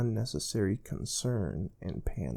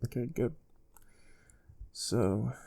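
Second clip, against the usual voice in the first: normal talking voice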